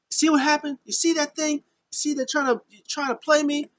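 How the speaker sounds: high, insistent paranoid voice; mock paranoid Sami. Sami telling Kevin he's being played, high paranoid voice. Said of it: "is like".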